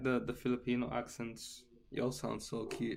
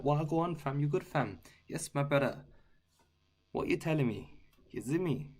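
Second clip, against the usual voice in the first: higher-pitched London roadman slang voice; roadman talk; 5 semitones higher than usual. Shown as a demonstration. When I'm talking with the friends, London roadman accent. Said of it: "the roadman type of talk".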